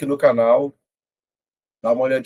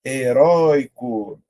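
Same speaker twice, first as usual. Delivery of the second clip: "Heróico" slowed, RÓI stretched; syllable by syllable; much slower than usual. Shown as a demonstration. Teacher testing the first option for stress, syllable by syllable. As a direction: draw out "Heróico", leaning on RÓI. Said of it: "Vou separar".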